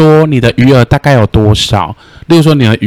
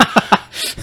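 hysterical laugh; 7 dB quieter than usual. Retold or imitated. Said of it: "他就整个歇斯底里".

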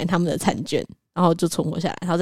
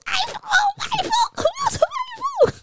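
excited squeal. Retold or imitated. Begging for the iPhone prize.

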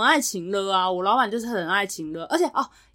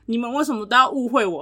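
normal talking voice

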